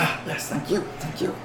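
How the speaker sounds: hushed creature gratitude; very soft, greedy little thanks; Gollum. Acted. Goblin thanking customer for coins, Gollum voice. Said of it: "I should do Gollum voice".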